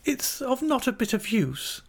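put-on very high, girlish young wife voice; Dora. A narrator's reading. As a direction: voice the child-wife far above narration pitch. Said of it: "said Dora".

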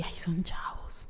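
slow, sarcastic whisper; mock whisper. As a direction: breathe it out, mocking the excuse. Mocking excuses for the boys.

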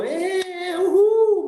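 high, drawn-out celebratory whoop; mock cheering. Husband reacting as the glitter falls, high mock cheering.

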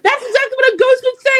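very high, fast laughing quip; joking laugh. Retold or imitated. Ghost joke to cohost, very high fast laughing quip. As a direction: toss it off high through a laugh.

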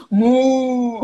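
laughing moo like a cow. Pretend. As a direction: moo out loud, playfully. Imitating the cow.

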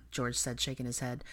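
usual speaking voice

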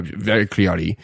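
ordinary talk

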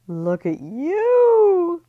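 high, loud, slow gushing screech; Libby screeching over Ellie's plate trick. Acted. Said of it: "Libby screeched".

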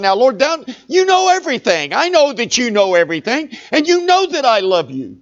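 high, pleading, pained answer; plead, hurt that he's asked again; emotional plea. Acted. Peter pleads with Jesus after the third question, emotional. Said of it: "there has to be some emotion".

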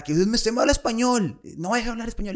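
high-pitched imitation of an angry heckler; hammed-up high-pitched complaint; mock irate scolding. Retold angry man scolding the speaker.

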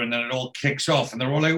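ordinary talk, nothing marked

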